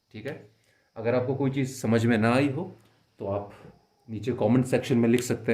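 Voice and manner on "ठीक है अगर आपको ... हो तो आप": ordinary delivery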